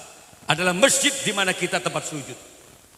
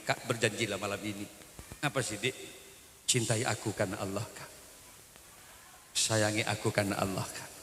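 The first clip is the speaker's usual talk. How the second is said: soft, lower and hushed; whispered plea; 7 semitones lower, 8 dB quieter than usual. Retold or imitated. Wife whispering to husband at night, soft and hushed. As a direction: murmur it close to his ear. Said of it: "dia bisikkan".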